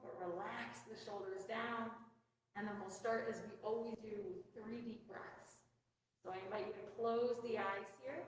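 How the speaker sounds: soft, soothing meditation voice; guided mindfulness. Real, not acted. Leads stretches and guided breathing.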